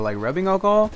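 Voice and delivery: retold innocent query with a big rise; feigned innocence. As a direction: question climbing high at the end. Playing innocent with the teacher.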